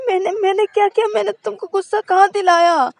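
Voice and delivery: high, loud, fast protest; bewildered defensive girl. A narrator's reading. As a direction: insist you did nothing wrong. Shruti defensive, shrill. (हैरान, ऊँची और तेज़ सफ़ाई)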